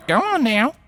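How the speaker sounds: lightly Southern, encouraging; mild Southern accent, coaxing. Acted. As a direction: nudge her along in a Southern accent. Earth pony spirit speaking.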